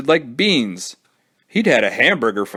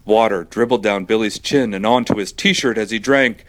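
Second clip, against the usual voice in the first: plain reading voice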